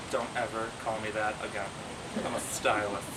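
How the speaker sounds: low, quiet imitated reproach; stern warning. Wife correcting husband who called her hairdresser, stern. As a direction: lay down the law softly and low.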